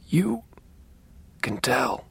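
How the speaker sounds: halting words, fading into whisper; weak, labored speech. A narrator's reading. Ailing father confides in his son, weak halting whisper. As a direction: squeeze out each word with long gaps. Dying father struggles to speak.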